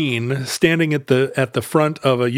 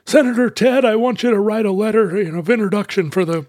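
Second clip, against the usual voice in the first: high, pompous plea; pompous dad quoting the senator; 6 semitones higher than usual. Retold or imitated. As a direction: put on a grand fatherly voice. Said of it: "and he said".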